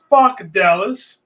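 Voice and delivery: slow, loud chant of the slogan. Retold or imitated. Quoting the Cowboys slogan at viewers, slow and loud. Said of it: "as the thing says".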